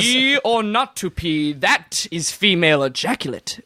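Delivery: slow, drawn-out theatrical declamation; mock Shakespearean. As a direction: announce it like a grand stage soliloquy. Repeats mock Shakespearean topic announcement.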